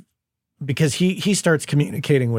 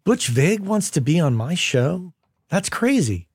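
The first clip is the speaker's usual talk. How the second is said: mock astonished, rising higher toward the end; retold disbelief; 4 semitones higher, slower than usual. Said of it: "And I was like".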